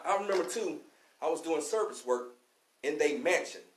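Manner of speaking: ordinary talk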